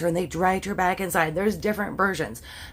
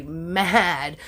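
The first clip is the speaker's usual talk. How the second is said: normal talking voice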